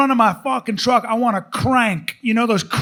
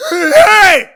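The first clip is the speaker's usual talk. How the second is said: loud, high yelped call; dog-name call sound; 9 semitones higher, 11 dB louder than usual. Shown as a demonstration. Owner calling his dog by a sound, high shout. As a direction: shout it as a wordless yelp. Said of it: "his name's gonna be a sound".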